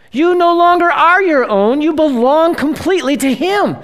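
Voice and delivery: a preacher builds to the peak of the point, fervent; fervent preaching. Real, not acted. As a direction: proclaim it with fire. Preaching climax.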